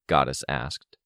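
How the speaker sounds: plain reading voice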